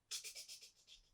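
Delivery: whispered tick tick; quiet ticking sound in the dark, whispered; soft ticking noise. Acted. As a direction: tap it out softly like a clock. Night noise imitated in bed.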